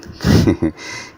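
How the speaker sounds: short breathy laugh of disbelief; scoffing chuckle. Real, not acted. Commentator mocks threats to fight America, scoffing chuckle. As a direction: chuckle at the absurdity of it.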